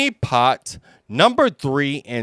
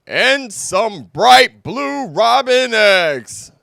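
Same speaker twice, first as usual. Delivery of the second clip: high, louder bouncy tiger; Tigger voice; 10 semitones higher, 6 dB louder than usual. Acted. Tigger adding robin eggs to the treasures, excited. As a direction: pipe up eagerly, much higher and louder. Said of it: "said Tigger".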